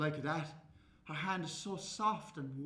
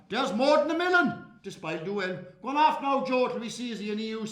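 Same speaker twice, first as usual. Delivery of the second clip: louder, jovial country shopkeeper; hearty shopkeeper; 5 semitones higher, 8 dB louder, faster than usual. Acted. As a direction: boom it out proudly. Shopkeeper answers and dismisses.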